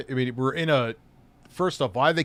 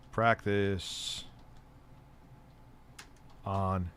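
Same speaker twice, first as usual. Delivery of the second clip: softer, drawn-apart typing voice; slow dictation; 3 semitones lower, 13 dB quieter, much slower than usual. Real, not acted. Streamer finishing a poll question for chat, slow dictation. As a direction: say each word quietly as it's typed.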